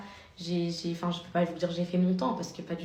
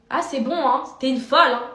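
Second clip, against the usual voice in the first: much louder, higher jab; loud retort; 6 semitones higher, 10 dB louder than usual. Retold or imitated. Vlogger replaying yelling at the woman, loud. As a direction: snap back loud and high. Retells her own insult.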